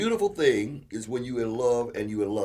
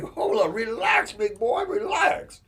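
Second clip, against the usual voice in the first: high, loud hold up, relax; mock calming; 12 semitones higher, 9 dB louder, slower than usual. Telling his hungry buddy to calm down, high and loud. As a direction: soothe the big guy, high and loud. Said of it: "you like".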